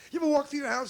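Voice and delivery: ordinary delivery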